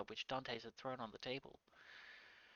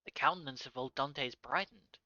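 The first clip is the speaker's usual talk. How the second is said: ordinary delivery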